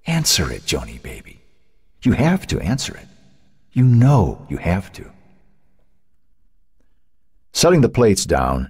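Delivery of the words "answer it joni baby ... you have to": whispering inner voice. A narrator's reading. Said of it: "Cynthia's voice was still speaking to her".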